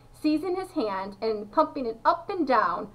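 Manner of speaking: ordinary delivery, nothing marked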